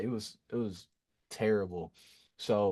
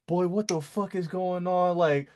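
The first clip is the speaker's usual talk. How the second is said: high, raised angry-mother voice; loud, indignant retold scolding; yelling mom; 9 semitones higher, 4 dB louder than usual. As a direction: imitate a mom yelling at her kid. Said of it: "she was yelling at me".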